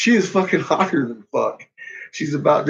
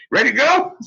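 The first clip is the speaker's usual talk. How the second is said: loud hurried exit; 9 semitones higher, 3 dB louder, faster than usual. Retold or imitated. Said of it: "I just put my arm around".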